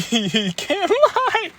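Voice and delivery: high, squeaky words through real laughter; laughing while talking. Real, not acted. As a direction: barely get it out for laughing. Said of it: "I'm sorry for laughing".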